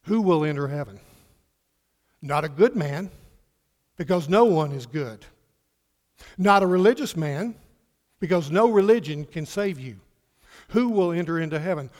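loud, punched phrases with pauses; emphatic preaching. Real, not acted. Preacher telling congregation who enters heaven, emphatic preaching. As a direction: hammer each point home to the congregation. Preaches the point at full force.

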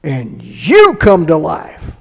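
loud, high, building sermon climax; rising revival preacher cry; preaching at full force. Acted. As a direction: preach it out to the whole arena.

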